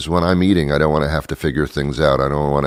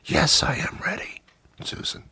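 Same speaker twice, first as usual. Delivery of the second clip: breathy sultry whisper; 10 semitones higher, 9 dB quieter than usual. Retold or imitated. Customer answering waitress Susan, seductive whisper. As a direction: whisper it like a come-on.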